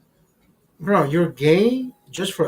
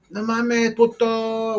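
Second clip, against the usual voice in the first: higher mock cheer in Spanish slang; rowdy group greeting; 5 semitones higher, much slower than usual. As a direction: toss out a cheeky welcome. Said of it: "everybody has their fucking welcoming comment".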